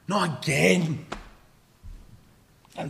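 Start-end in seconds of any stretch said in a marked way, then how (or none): 0.1-1.1 slow, drawn-out exasperated complaint; emphatic, long-drawn weary protest; exasperated dismay. Acted. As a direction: stretch it out in fed-up disbelief. Disciple's repeated, stronger complaint.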